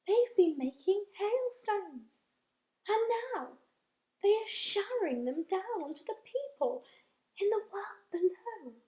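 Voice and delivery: high, hushed, eager explaining; excited whisper. Acted. James explaining to friends, high hushed excitement. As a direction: keep it secret but thrilled. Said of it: "whispered James excitedly".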